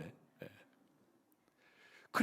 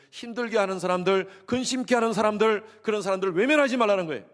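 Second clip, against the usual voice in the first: rising, louder preaching build; 6 semitones higher, 7 dB louder than usual. Real, not acted. Pastor to congregation, building to a loud emphatic plea. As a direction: press the point harder each phrase.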